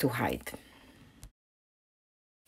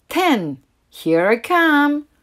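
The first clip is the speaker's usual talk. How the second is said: loud, high, playful call; Mama announcing to every hidden duckling; Mama calls out to her ducklings, loud and cheery; 8 semitones higher, 6 dB louder, slower than usual. A narrator's reading. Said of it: "Mama called out".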